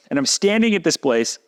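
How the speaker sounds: ordinary delivery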